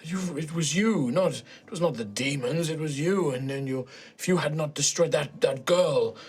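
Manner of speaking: halting, regretful villain mourning lost work; broken old man. Acted.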